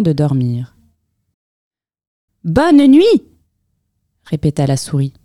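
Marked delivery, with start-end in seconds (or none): 2.4-3.2 high, loud mouse voice; squeaky and loud; louder mouse goodnight. A narrator's reading. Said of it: "un peu plus fort".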